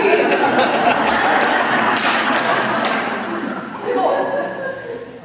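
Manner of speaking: very high, loud breathy laughter; real laugh. Real, not acted. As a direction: crack up helplessly. Laughing at fifty dollars short.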